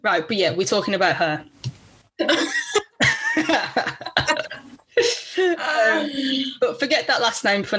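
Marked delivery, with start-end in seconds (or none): 2.2-4.5 long, high-pitched laughing fit; amused laugh. Real, not acted. Amused at the name confusion.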